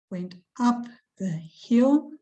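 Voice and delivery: "up" and "hill" marked, the rest breathed; slow, hushed line, "hill" rising; stress on up and hill. Shown as a demonstration. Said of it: "Where is the emphasis".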